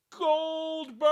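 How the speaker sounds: high mock yell of a name; rich dude yelling. Rich man calling his musician from bed, high mock yell. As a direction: call down to the basement in a high bark. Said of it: "he would yell".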